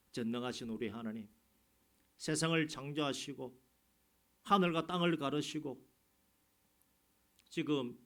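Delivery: ordinary talk, nothing marked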